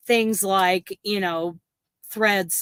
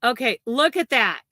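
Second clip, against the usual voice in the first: normal talking voice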